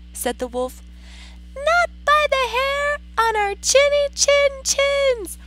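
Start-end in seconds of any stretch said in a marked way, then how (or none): 1.5-5.4 very high squeaky pig voice. Acted. Pigs refusing the wolf, high squeak. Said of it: "the pigs shouted".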